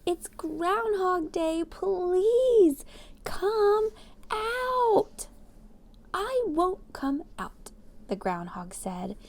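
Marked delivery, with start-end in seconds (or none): 0.1-5.3 high, loud call of a crowd. A narrator's reading. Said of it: "said with a shout". 6.1-7.7 stubborn groundhog refusal. A narrator's reading.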